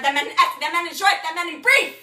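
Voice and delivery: mounting, emphatic declamation; building rant. Acted. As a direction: hammer each phrase harder, climbing in pitch. Acted monologue, list builds up.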